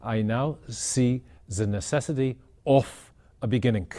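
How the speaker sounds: imitated German accent, words set apart; mock-solemn German-accented pronouncement; heavy German accent. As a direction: quote it in a thick German accent, deliberately. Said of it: "in his heavy German accent".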